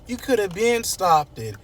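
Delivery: high-pitched, wounded protest; mock distraught reproach, high and halting; upset accusing daughter. Acts Kat accusing Eva.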